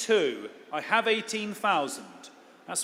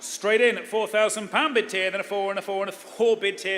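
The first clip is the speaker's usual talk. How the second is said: quick, lively auction calling; auctioneer; 3 dB louder than usual. Acted. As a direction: rattle off the rising bids.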